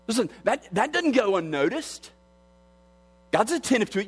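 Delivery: high, ringing preacher's voice lifting each phrase; fervent preaching. Acted. A preacher telling the congregation God sees, preaching high. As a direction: lift the congregation up with it. Preacher quoting scripture, rising.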